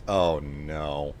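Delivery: normal talking voice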